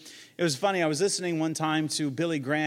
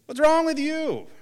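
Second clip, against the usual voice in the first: much higher, louder mocking husband; clueless husband; 13 semitones higher, 6 dB louder than usual. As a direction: blurt it like the baffled dope husband. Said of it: "if I replied to my wife like that".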